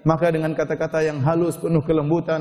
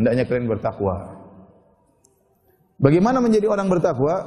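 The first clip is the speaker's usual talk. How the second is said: usual speaking voice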